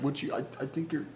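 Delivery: high, soft, hesitant asking-out voice; timid nervous guy. Retold or imitated. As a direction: mimic a shy guy stammering to his crush. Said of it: "ugh, don't fucking say that".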